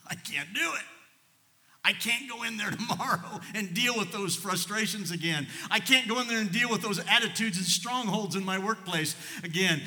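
high, loud, laughing protest in a mock whine; mock despairing wail. A worn-out worker moans to God about the office, laughing, high and loud. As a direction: wail it like someone at the end of their rope.

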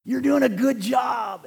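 high, slow shouted praise in character; loud, drawn-out impression of a hearty supporter; Gene Crail. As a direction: holler encouragement in his put-on voice. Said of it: "You know his mannerisms, how he talks?".

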